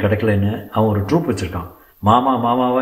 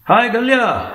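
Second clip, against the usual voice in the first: high-pitched, called-out hello; calling greeting; 12 semitones higher, 3 dB louder, much slower than usual. A narrator's reading. Seenu greets Akalya on arriving, calling out high.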